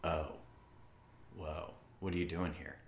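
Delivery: low, soft come-on; seductive Brooke voice. Pretend. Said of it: "I'm like".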